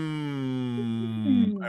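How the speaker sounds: long hum gliding down from high; resonance hum. Shown as a demonstration. Coach demonstrates finding resonance center, descending hum. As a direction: start up high and slide the hum down. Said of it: "start up here".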